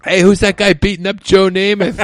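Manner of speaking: high, loud, hammed-up holler; mock onlooker shout. Acted onlooker in a joke.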